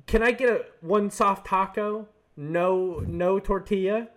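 high, slow put-on customer voice; scheming customer. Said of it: "and he goes".

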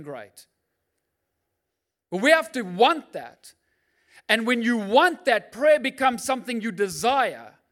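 loud, rising, driving sermon voice; preaching at full force. Acted. Preacher firing up the congregation, loud and driving.

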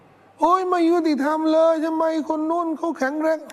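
high, slow mock moan about unfairness; whining complainer. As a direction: play a sick rich man groaning that life is unfair. Imitating a sick person complaining.

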